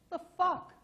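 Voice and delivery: high, loud mock disbelief; hammed-up shocked reaction; incredulous outburst. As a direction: re-enact a baffled double take. Retold baffled reaction to Stan.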